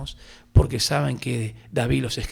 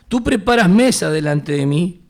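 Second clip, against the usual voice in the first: proclaimed verse; 5 semitones higher, 8 dB louder than usual. Acted.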